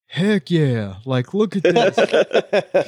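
so into it, mock-thrilled, breaking into laughter; retold excitement. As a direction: imitate a guy loving his suite, pitch lifting into a laugh. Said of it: "he's so into it".

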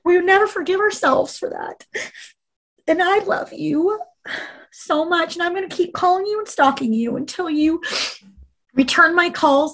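high, breaking voice pleading with her sister; tearful pleading. Real, not acted. Retelling her plea to her sister, voice high and breaking. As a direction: choke back tears as you beg.

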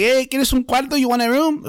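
put-on high-pitched Spanish-tinged accent; foreign clerk's accent. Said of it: "That's how he spoke".

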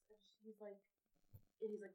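normal talking voice

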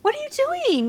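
high, squeaky mock greeting. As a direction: imitate a squeaky-voiced woman. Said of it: "little Miss Squeaky Voice".

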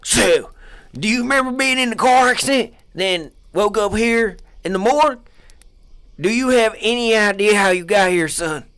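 high, loud mock interrogator; loud interviewer act; hammed-up interviewer. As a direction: press him hard. Interviewer role.

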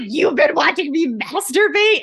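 high, startled disbelief; high-pitched mock outrage; shocked gasp. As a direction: squeal it out, scandalised. Shocked woman in retold story.

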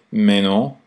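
lightly French mais non with a rise. Retold or imitated. Host imitating French people, light French accent. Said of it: "like French people say".

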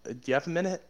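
a hint of an eager canvasser; approaching stranger. Stranger stopping a student, acted.

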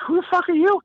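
sudden high startled demand; startled who are you. Retold or imitated. Engineer startled by a stranger at his door, high and shocked.

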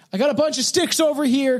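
high-pitched mock call; acted snake-woman. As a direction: play the creature calling out, hammed-up. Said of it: "She's like".

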